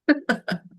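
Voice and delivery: loud burst of laughing; burst of laughter. Real, not acted. Audience hearing the caller's slip, bursting out laughing.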